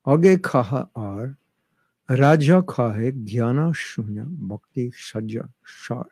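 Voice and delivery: slow Bengali-accented recitation of "Jnana shunya bhakti", rolled r in "Raja". Shown as a demonstration. Teacher who lived in Mayapur shows the class his Bengali accent. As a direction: recite the verse in your best Bengali accent, slowly. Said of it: "give you my best Bengali accent".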